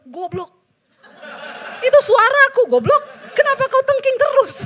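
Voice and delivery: loud, high laughing rebuke; God scolding. Retold or imitated. Preacher voicing God scolding her, loud laughing shout. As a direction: bellow the rebuke through laughter. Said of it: "Tuhan bilang".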